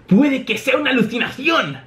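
high, gleeful character voice; acted jubilation; celebrating. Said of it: "lo celebra Usopp".

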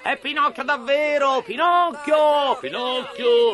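very high puppet cheers; joyful shouting. Acted. Puppets recognising their wooden brother, overjoyed shouts. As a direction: call out his name in delight.

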